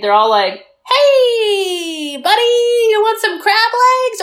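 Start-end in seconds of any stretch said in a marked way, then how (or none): 1.2-4.2 high, drawn-out mock-friendly greeting; fake friendly. Mimicking people who don't know how to deal with him, fake friendly. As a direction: overdo an awkward, singsong welcome. Mimicking awkward welcome-home crowd.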